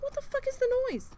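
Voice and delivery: shrill high cry of alarm; way up high, panicked disbelief. Retold or imitated. Startled by a howling dog, a high alarmed outburst. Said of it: "I'm like".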